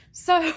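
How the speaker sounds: sudden squealing laugh; high burst of laughter. Real, not acted. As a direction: laugh out loud, high and bright.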